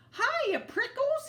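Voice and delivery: very high, loud and slow jackrabbit call; shouted greeting. A narrator's reading. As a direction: holler a cheeky hello. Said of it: "he, she shouted".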